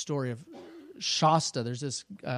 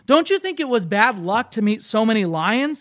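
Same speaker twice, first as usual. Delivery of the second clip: put-on raised, loud voice of an upset boy; protesting boy; 7 semitones higher, 9 dB louder than usual. A narrator's reading. Boy complains to the large voice about lions, protesting. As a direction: push it higher and louder in protest.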